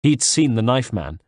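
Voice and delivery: usual speaking voice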